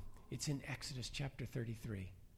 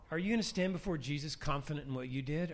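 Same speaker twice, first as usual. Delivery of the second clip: ordinary delivery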